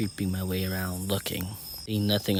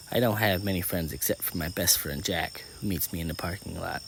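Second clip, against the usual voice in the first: usual speaking voice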